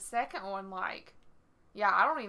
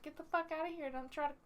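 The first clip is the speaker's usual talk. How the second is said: higher, mock-indignant yelp; mock outrage; 6 semitones higher, 8 dB quieter than usual. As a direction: squeak it out like an offended girl. Retelling her outraged reply.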